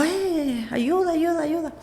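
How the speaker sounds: high, contained cry for help; imitated call for help. Woman retelling flagging down a taxi after the crash, imitated call for help.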